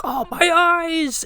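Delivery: very high, slow wailing scream; screaming Cage. Retold or imitated. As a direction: howl it like a panicked madman. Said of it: "the Scrooge's like".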